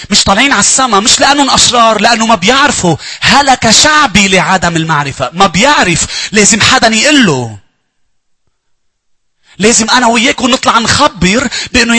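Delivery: loud, high fervent sermon voice; preaching at full force. Acted. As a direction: proclaim it to the whole church. Preacher proclaims to congregation.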